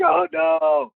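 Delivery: high yelped oh no; high mock-panicked cry; mock dread. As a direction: dread the call coming. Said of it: "I'm like".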